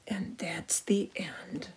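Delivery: hushed, slow storybook ending; whisper. A narrator's reading. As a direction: whisper the end gently. Closing the story.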